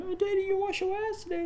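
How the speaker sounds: high mock child voice, teasing daddy; cheeky little kid. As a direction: pipe up like a sassy toddler. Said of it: "he probably gonna say something".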